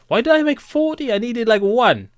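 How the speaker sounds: high, loud cry of disbelief at his own mistake; exasperated disbelief. Real, not acted. Scolding himself for crafting forty instead of one, high and loud. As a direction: squeal out how absurd the mistake is.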